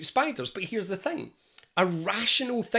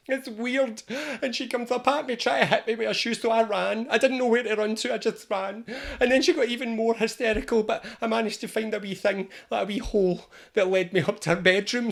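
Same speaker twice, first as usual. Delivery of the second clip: high spider voice, gradually settling; shaken spider; 6 semitones higher than usual. Acted. Spider telling its support group how it ran, comically shaken. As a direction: recount the escape in a high, rattled voice. Spider retells escape, calming slightly.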